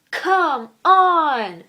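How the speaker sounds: loud, drawn-out exasperation; exasperated. Real, not acted. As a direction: groan it out loudly at whoever is to blame. Exasperated homework rant.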